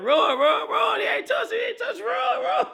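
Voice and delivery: high, loud yelling of run; hoarse cheering shout. Retold or imitated. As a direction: scream at the runner to go. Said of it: "I'm like".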